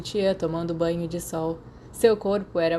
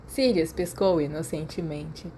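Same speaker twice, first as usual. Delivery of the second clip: usual speaking voice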